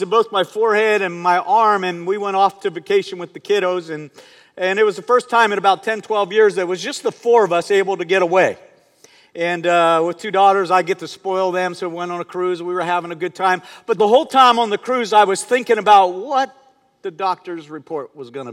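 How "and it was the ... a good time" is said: usual speaking voice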